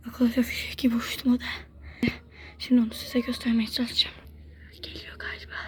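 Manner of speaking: hushed whisper while hiding. Real, not acted. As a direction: whisper so nobody hears. Hiding, telling viewers quietly.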